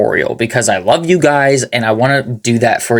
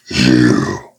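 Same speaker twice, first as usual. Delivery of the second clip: very deep, dark growl; too deep; 12 semitones lower than usual. Shown as a demonstration. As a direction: push the growl too low. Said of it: "No, that's too deep".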